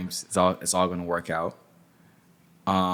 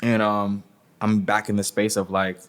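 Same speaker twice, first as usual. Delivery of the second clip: usual speaking voice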